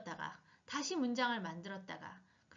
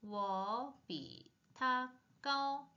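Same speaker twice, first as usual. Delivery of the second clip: slow drill; much slower than usual. Shown as a demonstration. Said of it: "slow model reading".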